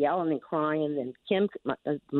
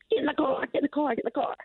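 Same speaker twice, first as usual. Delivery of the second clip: mock urgent yell; 11 semitones higher, faster than usual. Grandmother retells parents herding children away from gunmen, high mock yell. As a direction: imitate parents yelling in panic. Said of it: "yelled at the children".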